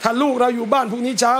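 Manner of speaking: ordinary talk, nothing marked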